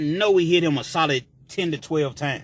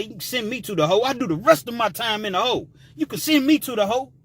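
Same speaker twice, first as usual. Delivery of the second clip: higher, quick, cocky dare; fast daring taunt; 7 semitones higher, faster than usual. Retold or imitated. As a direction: rattle it off like you don't care.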